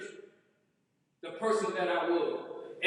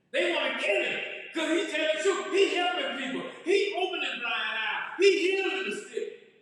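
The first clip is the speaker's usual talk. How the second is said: rising preaching fervour; 8 semitones higher than usual. Real, not acted.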